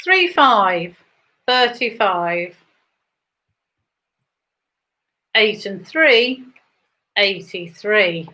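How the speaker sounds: rising bingo number call; bingo caller. Acted.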